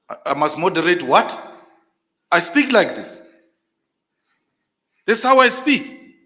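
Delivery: insisting loudly over the chair; shouting. Real, not acted. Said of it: "you are shouting now".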